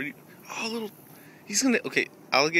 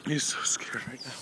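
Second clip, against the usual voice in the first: hushed whisper; 4 semitones lower, 6 dB quieter than usual. Real, not acted. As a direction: whisper it under your breath. Whispered aside about a friend.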